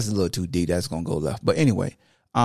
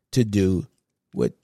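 ordinary delivery

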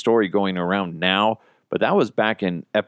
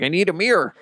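high dopey voice asking for a mirror; dim friend voice; 10 semitones higher, faster than usual. Retold or imitated. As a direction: play the clueless friend, pitched up. Imitating the gullible friend.

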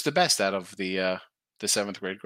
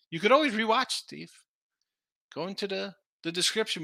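normal talking voice

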